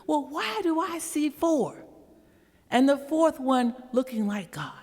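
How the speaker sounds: high, amazed question; can't believe his eyes; lightly voiced amazed king; astonished king. King sees fourth man.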